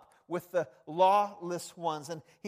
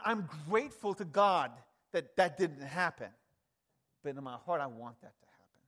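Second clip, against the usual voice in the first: usual speaking voice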